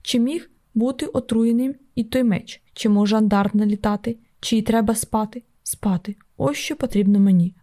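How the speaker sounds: ordinary delivery, nothing marked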